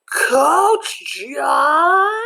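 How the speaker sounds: high, loud shocked rise on the name; horrified surprise. A narrator's reading. Kid narrator dreading the coach, shocked and loud.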